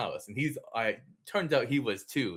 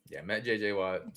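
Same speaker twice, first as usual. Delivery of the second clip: ordinary talk, nothing marked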